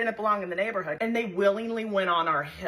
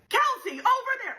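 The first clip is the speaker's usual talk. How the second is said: hammed-up game call; 4 dB louder than usual. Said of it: "he's doing the full thing".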